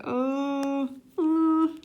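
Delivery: high, drawn-out nervous ooh; a little scared. Retold or imitated. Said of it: "getting a little scared".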